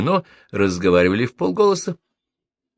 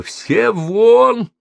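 shrieked high and loud; stamping and screaming everyone out; 14 semitones higher, 7 dB louder than usual. A narrator's reading. Furious landlady screams at the guests, shrieking. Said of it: "затопала и завопила".

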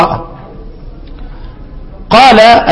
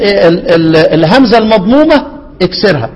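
normal talking voice

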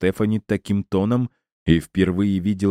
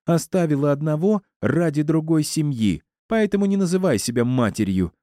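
high-pitched, heated accusation; furious woman's high voice; angry tirade; 10 semitones higher than usual. A narrator's reading. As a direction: hurl accusations in a raised pitch. Angry Стефани continues her quarrel.

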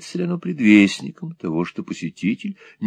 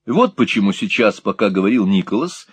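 plain reading voice

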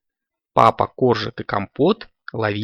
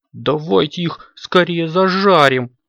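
higher, drawn-out eager plea; urging; 6 semitones higher, 4 dB louder than usual. A narrator's reading. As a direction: urge everyone on with stretched words. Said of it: "поторапливал всех Компот".

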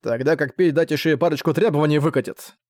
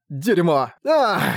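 outburst; 4 semitones higher, much slower than usual. A narrator's reading. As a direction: curse then a sharp high cry. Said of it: "не выдержал Уэйн". (срыв)

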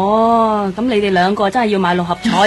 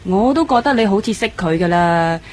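usual speaking voice

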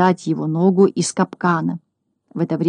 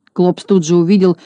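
usual speaking voice

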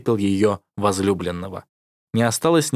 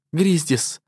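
crying silently; 7 semitones higher than usual. A narrator's reading. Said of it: "она плакала беззвучно".

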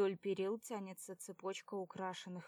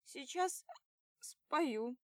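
put-on much higher, playful drunk squeal; drunk threat; 7 semitones higher, 4 dB louder, much slower than usual. A narrator's reading. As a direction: tease like a tipsy girl.

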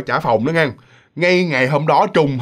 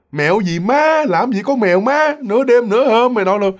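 higher-pitched, irritated retort; acted exasperated dismissal; 6 semitones higher than usual. Woken roommate says there is no cat at midnight, exasperated. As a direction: brush off the silly question in a raised pitch.

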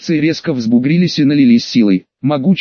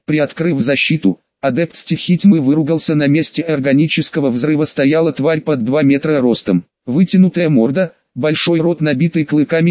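ordinary delivery

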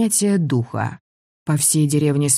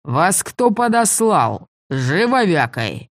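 pirate voice; 4 semitones higher, slower than usual. A narrator's reading. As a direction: bark it like a pirate. Pirate interrogates.

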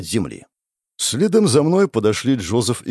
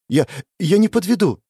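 eager stammer; 8 semitones higher than usual. Acted.

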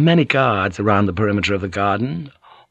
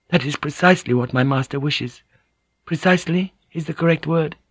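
higher, measured voice of a court lady; Mariko's voice; 7 semitones higher than usual. A narrator's reading. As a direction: lift the voice into a poised noblewoman listing. Mariko corrects him.

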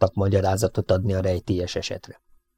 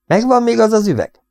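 much higher, eager question; keen questioning; 13 semitones higher, 4 dB louder than usual. A narrator's reading. Siegfried asks the farmer for the bottle, keenly. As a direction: pitch it up high, on the scent.

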